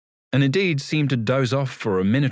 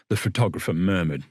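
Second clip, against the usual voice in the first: ordinary delivery, nothing marked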